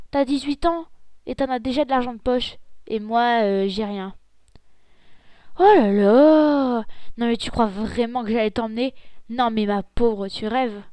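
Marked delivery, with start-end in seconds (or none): 5.6-6.8 loud, very slow oh là là; loud, drawn-out scoff; exasperated. Acted. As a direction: drag it out, fed up with her. Big sister annoyed by request.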